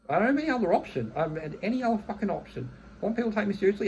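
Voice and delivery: venting that he had no other choice; real frustrated outburst. Real, not acted. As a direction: let the frustration boil over. Ranting about being forced into anger.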